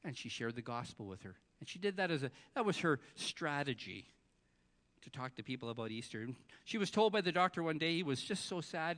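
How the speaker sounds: usual speaking voice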